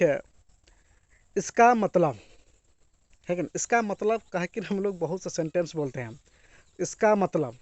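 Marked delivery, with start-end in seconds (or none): none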